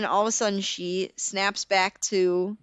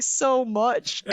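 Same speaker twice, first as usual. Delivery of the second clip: laughing answer; 4 semitones higher than usual. Retold or imitated. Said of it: "And I'm like".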